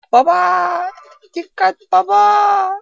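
put-on very high, loud child-like scream; shrieked warning. Game child warning dad of danger, high mock shriek.

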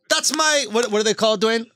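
ordinary talk, nothing marked

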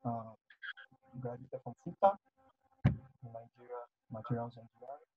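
very faint, far from mic; speaking much too softly; barely audible. Real, not acted. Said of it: "Your voice is very low".

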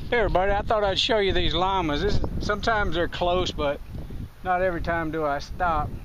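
ordinary talk

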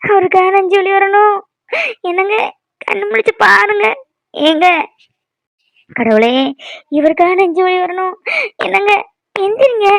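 distraught wife crying out; anguished pleading. Acted. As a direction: wail and beg him to wake. Wife pleading over unresponsive husband.

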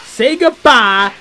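yelling the Kamehameha as he fires it; battle cry. Acted. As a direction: announce the finishing blast. Firing special attack.

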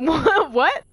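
surprised laugh. Real, not acted. Laughing shock in game.

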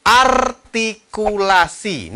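ARTIKULASI very slow, high and loud, syllable by syllable; over-enunciate "artikulasi", stretching each syllable; artikulasi sangat jelas. Shown as a demonstration. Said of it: "ini perlu kejelasan banget". ("artikulasi" lantang, lambat, sangat jelas)

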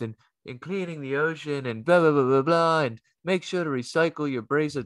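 sing-song corporate message, hammed up; mock announcement voice. Comic telling the crowd about the show's eco message, mock announcer voice. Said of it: "they have this message like".

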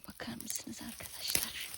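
soft unvoiced whisper. Real, not acted. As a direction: keep it to a whisper. Whispering while foraging.

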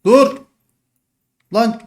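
high loud stop; sharp shout. Real, not acted. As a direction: yell it in sudden panic. Sudden panic in fight. (ani bağırış)

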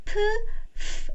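just breathe out 프; P sound demo. Shown as a demonstration. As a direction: phonics demo of 프. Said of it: "이렇게".

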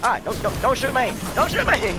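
high, loud panicked yelping; panicked plea. Real, not acted. Player under fire begging not to be shot, panicked.

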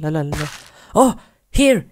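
normal talking voice

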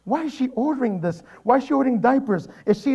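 high-pitched alarmed questioning; comic rising alarm; retold panic. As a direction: fire off mock-frantic questions. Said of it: "I was scared".

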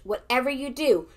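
ordinary talk

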